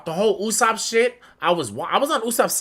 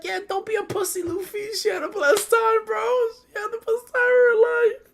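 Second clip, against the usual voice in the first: high, breaking, half-crying; high tearful mock sob; mock teary plea. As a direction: plead through fake tears. Retelling own teary reaction.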